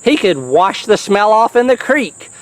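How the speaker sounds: ordinary delivery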